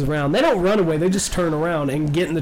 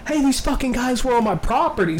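higher-pitched mock outrage; indignant complaint; 7 semitones higher than usual. Homeowner runs up to the cop car, indignant. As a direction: imitate a homeowner tattling to police.

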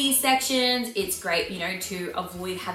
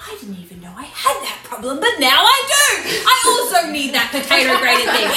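excited realization; 5 semitones higher, 9 dB louder than usual. Retold or imitated. Viewer hooked by an ad.

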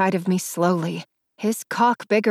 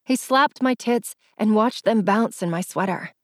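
plain reading voice

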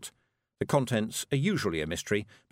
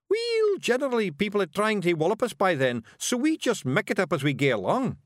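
high Scots explanation; breezy Scottish Feegle; 10 semitones higher, 4 dB louder than usual. A narrator's reading. Rob telling Miss Level they improvise in fights, breezy.

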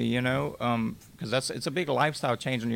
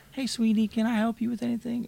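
put-on much higher, sugary old-lady voice; sweet old lady; 11 semitones higher than usual. Old lady offers help in wheelchair, sugary high voice.

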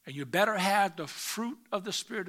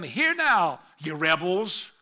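loud, harsh call of Moses to the rebels; angry rebuke; 7 dB louder, slower than usual. Acted. Moses rebuking the complaining congregation, angry. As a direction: scold them as rebels. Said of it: "can you hear the, the anger in his voice?".

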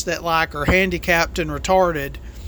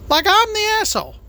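much higher, fast, incredulous echo; mock outrage; 12 semitones higher, faster than usual. Trucker echoing how cyclists see him, in mock outrage. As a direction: fling the words back in mock disbelief. Repeats complaint, then mocks it.

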